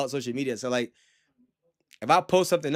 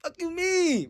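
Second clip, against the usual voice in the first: higher, indignant fuck you mean; pitched-up outrage at a car insult; retold angry outburst; 13 semitones higher, slower than usual. Retelling his reaction to the car insult, indignant and pitched up. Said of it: "I almost lost my cool".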